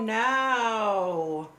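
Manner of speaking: usual speaking voice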